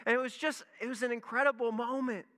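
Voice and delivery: usual speaking voice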